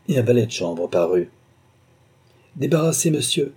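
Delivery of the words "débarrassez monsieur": ordering. A narrator's reading. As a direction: snap an order at the valet. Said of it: "ordonna Lord Dunmore".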